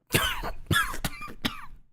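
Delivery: wet, choking cough; bloody cough. A narrator's reading. Narrator coughing blood while dying. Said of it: "I cough again".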